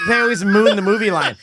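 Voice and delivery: ordinary talk, nothing marked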